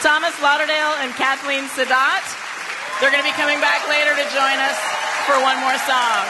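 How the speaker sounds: projecting host thanks to the room; loud announcement over applause. Real, not acted. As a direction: call it out over the clapping.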